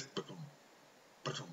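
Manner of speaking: thump it like a heartbeat; heartbeat rhythm. Shown as a demonstration. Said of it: "listen to your friend's heartbeat".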